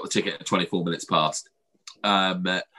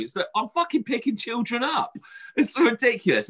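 much higher, indignant; outraged retort; 13 semitones higher than usual. Retold or imitated. Telling off the warden, outraged. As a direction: rise in disbelief.

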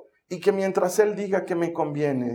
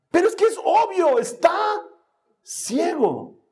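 high, exasperated outburst; 11 semitones higher than usual. Acted. Preacher protesting Jesus' question about the blind man, hammed-up exasperation. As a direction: exclaim it as blindingly obvious. Acting exasperation at an obvious answer.

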